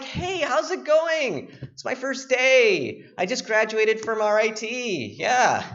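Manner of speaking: eager new intern. Retold or imitated. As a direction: play the naive new guy. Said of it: "I'm like".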